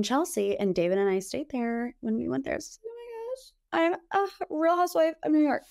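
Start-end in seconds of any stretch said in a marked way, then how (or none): 2.6-5.6 higher and fast, a giddy squeal; mock gushing. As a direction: gush like a thrilled reality star, hamming it up. Gushing about being a housewife.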